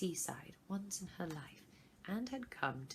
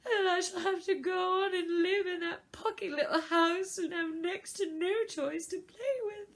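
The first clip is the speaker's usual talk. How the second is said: much higher and louder, crying through the words; a child sobbing out her fears, much higher; tearful; 12 semitones higher, 8 dB louder than usual. A narrator's reading. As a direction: wail it like a child on the edge of sobbing. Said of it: "her eyes filled again with tears".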